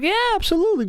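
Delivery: high-pitched, over-keen yes; mock eager agreement. Eager reply in retold talk.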